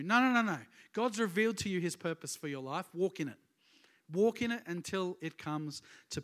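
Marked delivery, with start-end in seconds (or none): 0.0-0.6 loud, higher, firm refusal; emphatic no. Real, not acted. Preacher shouting down a lazy excuse, loud and firm. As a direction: shake off the idea, loudly. Preacher rejects passive waiting.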